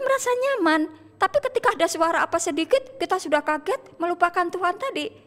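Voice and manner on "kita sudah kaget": usual speaking voice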